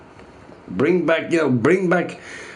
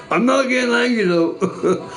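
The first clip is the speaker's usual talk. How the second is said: exasperated protest; 9 semitones higher than usual. Real, not acted.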